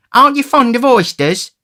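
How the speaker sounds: very high, loud questioning voice; high-pitched greedy character; tipsy oafish youth. A narrator's reading. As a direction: ask it loud and high, tipsily curious. Noah questions Charlotte.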